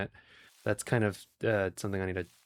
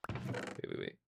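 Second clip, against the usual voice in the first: hushed whisper, much softer; 15 dB quieter, slower than usual. Real, not acted. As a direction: breathe it out in a whisper. Sudden discovery in game.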